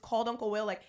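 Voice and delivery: usual speaking voice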